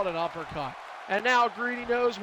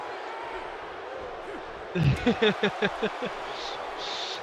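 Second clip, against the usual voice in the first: breathless laugh. Real, not acted. Laughing at tennis video story.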